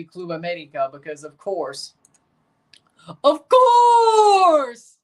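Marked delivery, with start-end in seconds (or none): 3.2-5.0 big high shouted impression, vowel held long; loud, drawn-out pundit holler; Cenk Uygur. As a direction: bellow it out, stretching the word COURSE. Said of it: "in my best Cenk Uygur voice".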